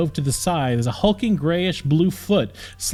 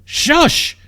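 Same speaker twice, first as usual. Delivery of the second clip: much higher, louder exclaimed shush; 6 dB louder than usual. A narrator's reading. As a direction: cut her off loudly. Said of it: "he exclaimed".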